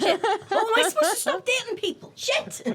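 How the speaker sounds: high, loud, fast, half-joking alarm; mock panic. Co-host joking about never stopping dating, mock panic. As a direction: play up sudden fake horror.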